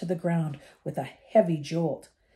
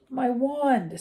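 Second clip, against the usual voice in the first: shaky voice; 6 semitones higher than usual. A narrator's reading. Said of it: "said Ron in a shaky voice".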